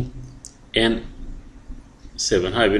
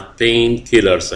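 Painkillers stretched out very slowly; teacher stressing a vocabulary word; drawn-out word; much slower than usual. Shown as a demonstration. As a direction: say it slowly so they memorise it. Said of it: "احفظها".

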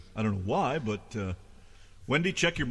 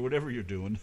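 ordinary talk

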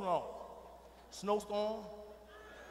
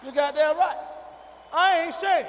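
loud, raised-pitch insistence; defiant declaration; 5 semitones higher, 9 dB louder than usual. Acted. Father admitting he blocks the boyfriend, defiant shout. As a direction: proclaim it without apology.

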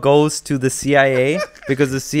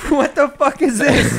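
higher, slow, laughing what-the; mock outrage through laughter; laughing disbelief; 10 semitones higher, slower than usual. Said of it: "we're like".

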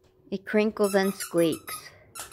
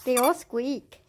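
higher, sing-song voice for the dog; high pet talk; 6 semitones higher, slower than usual. Real, not acted. Mom coos to her dog about the squeaky toys, high. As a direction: coo it up to the puppy.

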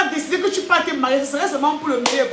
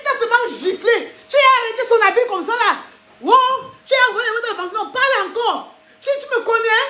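put-on furious girlfriend; 5 semitones higher than usual. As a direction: shout down the boyfriend.

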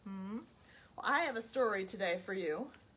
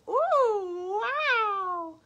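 admiring pig; 9 semitones higher, 5 dB louder than usual. Acted. The pig admiring her fancy boots, high voice. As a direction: swoon over the boots.

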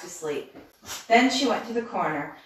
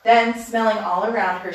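plain reading voice

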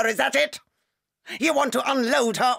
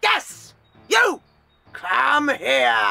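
barked order; 3 dB louder, much slower than usual. Acted. Headmistress ordering pupil over.

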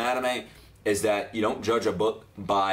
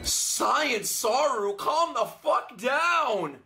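high, slow drawn-out yell; exasperated shout; 12 semitones higher, much slower than usual. Real, not acted. Telling the transformed character off, a slow high yell. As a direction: holler at the screen for him to chill.

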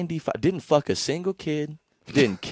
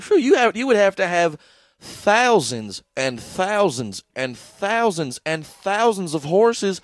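high, loud, drawn-out comic disbelief; mock amazement; 8 semitones higher, 6 dB louder than usual. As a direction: pile on the exaggerated astonishment slowly. Imagined seller marvels at the quantity.

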